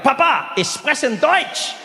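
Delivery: high, excited German announcement; eager surprise. Pretend. Son surprising his dad with his German, eager. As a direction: proudly show off your German to Papa.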